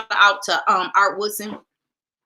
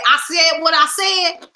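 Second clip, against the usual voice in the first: defiant quote; 8 semitones higher, 6 dB louder, slower than usual. Retold or imitated. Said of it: "and you said".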